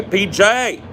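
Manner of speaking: mom's high sing-song call across the yard. Retold or imitated. Mom calling her son in from outside, high called name. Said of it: "she would call out".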